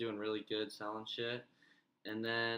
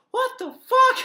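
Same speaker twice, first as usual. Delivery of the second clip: very high, very loud mock-panicked yell; retold startled shout; 19 dB louder, slower than usual. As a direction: yell it as if freaking out. Retells own reaction while peeing.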